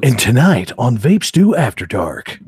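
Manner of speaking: slow, loud broadcast opening; newscaster voice. Acted. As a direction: announce it like a news anchor. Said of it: "the, the newscaster voice".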